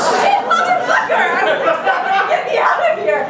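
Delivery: high, loud, laughing yell of panic; panicked yell. Retold or imitated. Stuck in the airport jail, she panics in a high laughing yell. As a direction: yell it out half laughing, fast.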